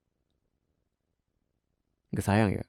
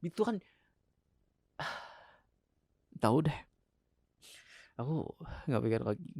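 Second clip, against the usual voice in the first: weary sigh; 3 semitones higher, 9 dB quieter than usual. Acted. Husband gives up, exhausted.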